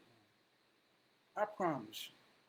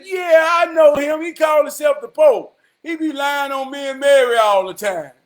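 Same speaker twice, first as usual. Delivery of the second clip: loud exclamation; 9 semitones higher, 16 dB louder than usual. Retold or imitated. The Holy Spirit shouting to Paul and Jesus about the Pope. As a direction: blurt it out with a laugh.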